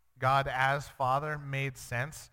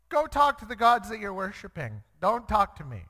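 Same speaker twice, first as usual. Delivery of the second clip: louder, higher acted dismissal; mock-indignant brush-off, raised voice; stern rebuff; 6 semitones higher, 4 dB louder than usual. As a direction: send them away as a fed-up God. God voiced rebuffing Israel.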